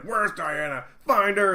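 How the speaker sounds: shouted demand, high and urgent; acting out a barking, freaked-out boss; frantic shouting. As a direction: bellow orders in a panic. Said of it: "Stop shouting at me".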